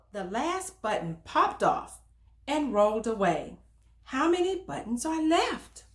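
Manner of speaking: put-on bright, lively read-aloud for little kids; reading to children. A narrator's reading. Storyteller reading aloud to children, animated storytime voice.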